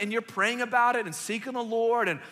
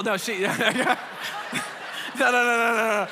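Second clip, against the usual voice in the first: laughing no; 3 semitones higher, 5 dB louder than usual. Real, not acted. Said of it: "laughing denial".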